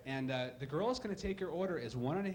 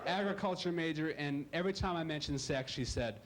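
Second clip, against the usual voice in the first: ordinary delivery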